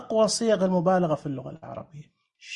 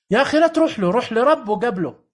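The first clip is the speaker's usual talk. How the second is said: higher and louder, urging; emphatic plea; 3 semitones higher, 5 dB louder than usual. Real, not acted. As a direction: call out to stop him. Emphatic exhortation.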